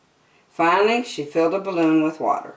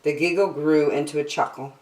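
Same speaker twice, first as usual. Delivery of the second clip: ordinary delivery, nothing marked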